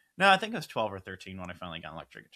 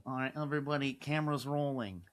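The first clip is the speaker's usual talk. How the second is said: higher, put-on mob boss voice; Godfather voice; 4 semitones higher than usual. Director addressing his crew on set, in a Godfather impression. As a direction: call the film crew as the Godfather. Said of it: "I'm gonna start talking like that".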